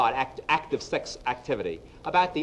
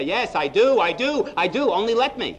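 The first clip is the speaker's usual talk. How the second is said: higher and louder, rushing to say yes; eager, too-keen vows; 7 semitones higher, 5 dB louder than usual. Shown as a demonstration. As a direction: blurt the vows like you can't wait. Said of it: "we say".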